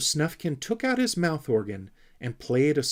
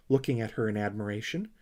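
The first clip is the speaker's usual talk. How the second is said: ordinary delivery, nothing marked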